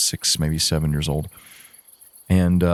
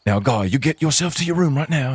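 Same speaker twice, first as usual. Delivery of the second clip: high-pitched, terrible attempt at a lord's accent; Lord Waldegrave; 8 semitones higher than usual. Lord sending a boy to his room, terrible put-on accent. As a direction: scold in a badly faked lordly accent. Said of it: "I'm terrible accent".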